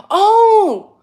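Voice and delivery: high loud startled oh; surprised oh. Real, not acted. Spotting a chat message, a startled oh. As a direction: yelp it. Sudden reaction.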